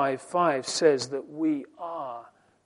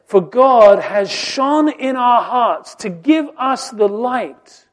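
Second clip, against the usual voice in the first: raised, higher voice, pressing each question; preaching at full force; 7 semitones higher, 11 dB louder, slower than usual. Acted. Preacher to his congregation on man's blindness, full-force preaching. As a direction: preach it out, rising with every question.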